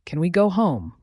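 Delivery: ordinary delivery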